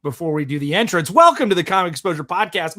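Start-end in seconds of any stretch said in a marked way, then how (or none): 1.1-2.8 podcast host welcome. Acted. Show opening greeting.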